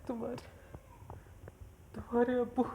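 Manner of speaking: choked, halting words through tears; crying. Acted. Mother breaks the news to her daughter, choked with tears. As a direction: struggle to get the news out. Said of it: "आप रो क्यों रही हैं?".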